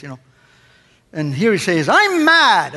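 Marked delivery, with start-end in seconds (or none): 1.9-2.7 higher, louder mock-angry boom; Tony Robbins big voice. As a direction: announce your anger like a motivational guru on stage. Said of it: "that big voice of his".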